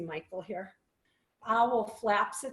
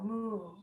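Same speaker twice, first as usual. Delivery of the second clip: cow moo; 4 dB quieter than usual. Shown as a demonstration. Yoga teacher to kids in cow pose, soft moo. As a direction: moo gently like a calm cow. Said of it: "Cow, moo".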